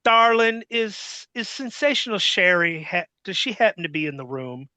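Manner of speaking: high, sugary old-lady wrestling matron voice, lightly put on; Fabulous Moolah. Wrestling boss on the phone asking sweetly for Sherry. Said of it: "Fabulous Moolah go".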